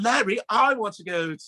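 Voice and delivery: high-pitched imitation of a demanding girl; mock her as a spoiled child begging to go; Willy Wonka girl. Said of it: "she sounds like, uh, you guys ever see Willy Wonka".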